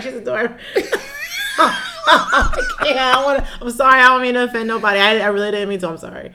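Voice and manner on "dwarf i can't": breaking into giggles, pitch climbing high; can't hold it together, laughing through the words. Real, not acted. Giving in to the joke with her podcast partner, laughing helplessly. Caught in giggles over dwarf.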